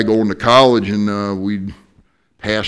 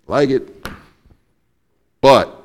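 boss voice; 6 semitones higher, 7 dB louder than usual. Retold or imitated. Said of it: "She's like".

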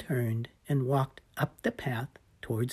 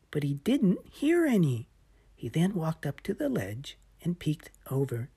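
ordinary delivery, nothing marked

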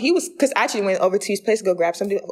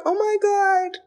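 high-pitched, swooning retold reaction; overwhelmed; 13 semitones higher, slower than usual. Telling friends how loved she felt, high and overwhelmed. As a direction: gush as if melting from being touched.

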